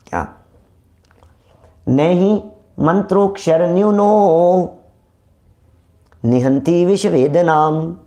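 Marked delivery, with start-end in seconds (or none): 1.9-8.0 slow, measured chant of a Sanskrit verse; धीमे स्वर में श्लोक पाठ; scripture recitation. Shown as a demonstration. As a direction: intone the shloka slowly like a temple reading. Repeats the verse slowly.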